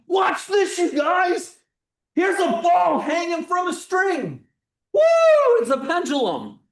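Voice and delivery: loud, high, whooping showman; hammed-up thrill, shouted to the back row; pretend excitement. Demonstrator showing students a pendulum, wildly over-excited. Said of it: "at least pretend to be excited".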